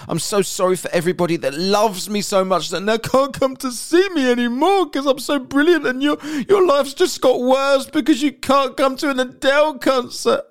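high, whiny fake blubbering; mock crying. Mocking the singer's apology to fans, fake tearful whine. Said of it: "Why are you crying".

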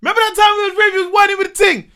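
gleeful high shout; loud hyped memory. Retold or imitated. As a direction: loudly bring up the rave with a mate. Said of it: "I'm in the house being like".

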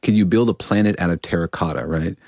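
ordinary talk, nothing marked

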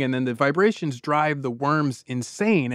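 ordinary talk

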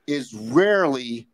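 ordinary talk

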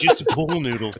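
laughing hard into a high cackle. Real, not acted. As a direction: repeat it and crack up.

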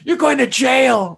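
slow high mock shout; imitating the cop. Quoting the cop arresting the teacher, slow shout. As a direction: bark it slowly.

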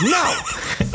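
high whiny mock no; petulant refusal. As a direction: refuse like a spoiled child. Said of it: "And I was like".

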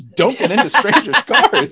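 slow, high, loud mock warning; laughing, hammered-home parental warning; drilled-in warning. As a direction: recite a childhood rule, stretched out through laughter. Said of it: "I was told over and over and over again".

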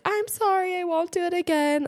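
high, put-on pleading kid voice; whiny toddler apology. Mom imitating her son's high, whiny apology during a meltdown. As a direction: beg forgiveness like a sorry toddler.

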